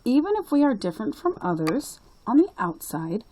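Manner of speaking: put-on high, eager little-girl voice; a small girl telling her grandpa; young girl Lucy.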